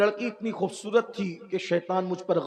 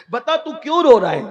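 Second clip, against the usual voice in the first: acted demand, raised high and loud; insistent question; 6 semitones higher, 9 dB louder than usual. Master asking a weeping young man why, insistent. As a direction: press him loudly for an answer.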